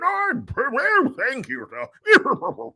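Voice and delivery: put-on high, hammy British lord accent. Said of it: "when I do the British Lord accent".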